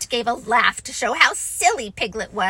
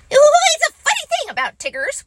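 high, fast, bouncy Tigger, louder, not whispered; Tigger's bouncy voice; 7 dB louder, faster than usual. A narrator's reading. Tigger boasting to Roo, high and fast. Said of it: "whispered Tigger to Roo".

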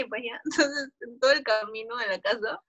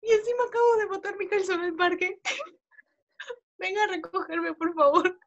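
lightly voiced pleading drunk girl, higher; high drunk whine; 7 semitones higher than usual. Drunk girl begging Yair to pick her up, high pleading whine. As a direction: beg to be picked up, a touch whiny.

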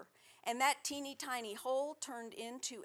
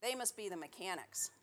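plain reading voice

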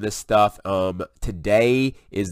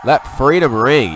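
rousing, loud and slow, lifting the words up; excited proclamation; 5 semitones higher, 8 dB louder, slower than usual. Real, not acted. Vlogger cheering along with the convoy clip, rousing and loud. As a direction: proclaim it like a rally cry.

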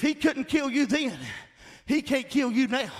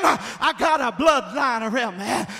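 usual speaking voice

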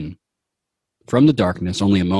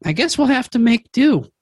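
Prince Jumanji; 12 semitones higher than usual. Acted. Prince Jumanji resigned after eating a creature. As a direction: shrug it off in the prince's voice. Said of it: "And he says".

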